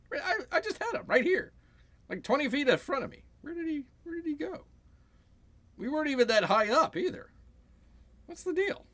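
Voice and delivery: mock confusion. A baffled enemy pilot who lost his target, high and confused. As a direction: squeak out the confusion.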